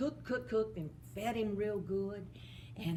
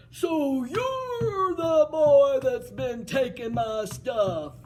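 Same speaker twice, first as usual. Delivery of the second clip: loud, slow giant bellow; angry giant; 6 semitones higher, 10 dB louder, slower than usual. Acted. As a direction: roar after the thief. Giant chasing Jack.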